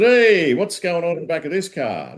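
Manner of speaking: high-pitched policeman's holler; mock cop calling out in surprise; Dave. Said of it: "in your voice".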